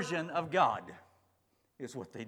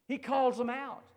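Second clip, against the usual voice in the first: normal talking voice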